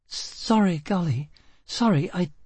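out of breath apology; gasping between words; breathless. A narrator's reading. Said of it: "she took a breath".

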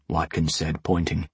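ordinary delivery

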